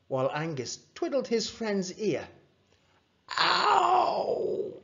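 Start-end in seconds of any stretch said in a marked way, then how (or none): 3.4-4.7 very high-pitched "ow"; sharp, drawn-out cry; yell of pain. A narrator's reading. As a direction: yelp as if your ear is twisted. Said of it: "yelled Rory".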